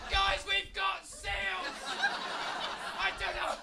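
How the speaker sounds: high, loud laughing glee; overjoyed at finding a seal at home; giddy excited rant. Acted. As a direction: gush with delight, almost shouting.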